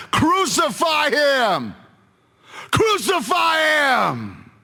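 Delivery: very high, drawn-out scream; strained, high-pitched, slow shout; mob shouting. Acted. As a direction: yell it like a crowd demanding death. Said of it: "they kept shouting".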